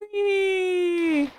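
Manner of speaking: sudden high cry; a real startled shriek; startled scream. Real, not acted. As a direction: yelp in fright at the game. Sudden scream in hardcore Minecraft.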